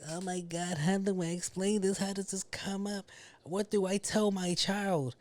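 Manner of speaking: a hint of a panicked mom; mock Kim. Kim seeing the tape ad, mildly mock-panicked. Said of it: "she was like".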